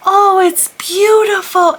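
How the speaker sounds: very high, slow, loud princess-like gush; four-year-old niece. Retold or imitated. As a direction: swoon like a little Disney princess. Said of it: "And that's how she talks, very high".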